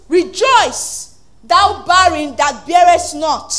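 loud, slow scripture proclamation; preaching scripture. Acted. Pastor to congregation, booming slow proclamation. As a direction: proclaim the verse slowly and loudly.

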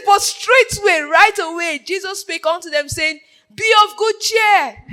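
high, loud scripture declamation; full-force preaching. A narrator's reading. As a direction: proclaim the verse to the whole congregation.

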